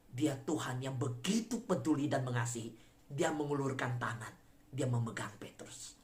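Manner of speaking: low, soft sermon voice; quiet pulpit tenderness; hushed, reverent preaching. Acted. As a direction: bring it down and speak gently, low.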